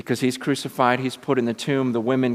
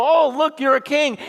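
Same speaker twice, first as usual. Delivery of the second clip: loud, high mock awe; hammed-up sarcastic marveling; 14 semitones higher, 7 dB louder than usual. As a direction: imitate a jeering mob feigning homage. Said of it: "they're totally mocking him".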